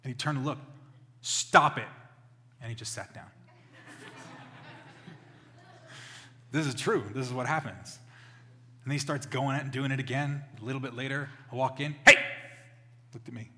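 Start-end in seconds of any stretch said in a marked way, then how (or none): 1.2-1.9 much louder, commanding reprimand; firm scolding. Retold or imitated. Father scolding puppy for biting, loud command. 12.1-12.2 sudden loud shout to stop a dog; one explosive reprimand; sharp call. Retold or imitated. Father in the other room to puppy, sharp shout.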